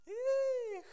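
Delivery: sudden high yelp of a partygoer; playful party cheer; mock party whoop. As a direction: whoop it up like you're on the dance floor. Party temptation acted out.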